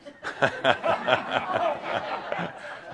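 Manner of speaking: real laugh. Real, not acted. Laughing at her own point.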